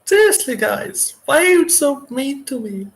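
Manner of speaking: put-on very high whiny girl voice; little girl voice. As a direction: complain like a small girl. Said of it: "She's just a little girl".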